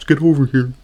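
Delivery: flat, bored Get over here with no push on "over"; deadpan. Retold or imitated. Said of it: "delivered far too deadpan".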